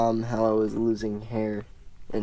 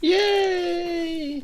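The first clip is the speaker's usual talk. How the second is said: mock cheer; 3 dB louder than usual. Mock cheer at being picked.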